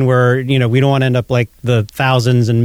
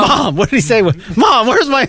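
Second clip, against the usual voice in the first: high whiny call to mom; yelling across the room; 9 semitones higher than usual. Retold or imitated. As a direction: whine up at Mom like a spoiled man-child. Imitating movie character calling mom.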